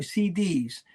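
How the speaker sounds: usual speaking voice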